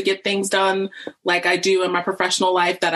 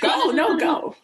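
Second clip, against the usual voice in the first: laughing urging; 12 semitones higher, slower than usual. Real, not acted.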